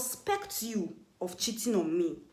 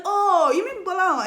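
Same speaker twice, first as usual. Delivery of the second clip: high, loud, slow, drawn-out mock surprise; 11 semitones higher, 9 dB louder, slower than usual. Answering the accuser about her boyfriend, high loud mock surprise. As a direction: act floored by the name.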